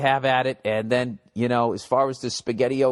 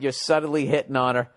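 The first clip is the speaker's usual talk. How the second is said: normal talking voice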